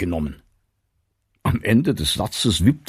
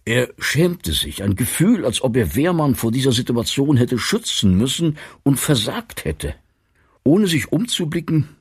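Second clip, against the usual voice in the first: ordinary delivery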